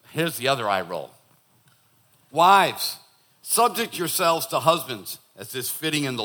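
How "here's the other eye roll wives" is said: normal talking voice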